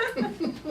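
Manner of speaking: short squeal of laughter; high laugh. Real, not acted. Retelling the owner's excuse, high burst of laughter.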